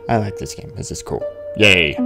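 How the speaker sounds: ordinary talk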